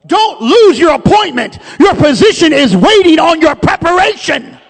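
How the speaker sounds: loud, high, driving sermon delivery; fervent preacher at full volume; full-force preaching. Real, not acted. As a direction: preach it out to the whole congregation.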